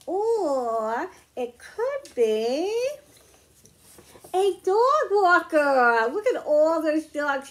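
slow, drawn-out build to a big loud reveal; excited reveal. Acted. Teacher reveals the answer to the children, drawn-out excited announcement. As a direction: hold them in suspense, then announce it high and loud.

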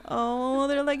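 ordinary talk, nothing marked

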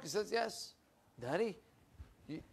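usual speaking voice

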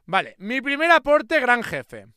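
high, loud mock-proud shout; hyped up. Real, not acted. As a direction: announce it proudly at the top of your voice.

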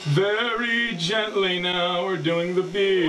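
slow, drawn-out words; careful, gentle narration. Acted. Shaving a friend's beard very gently, slow and careful. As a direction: take your time as if doing delicate work.

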